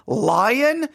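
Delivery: usual speaking voice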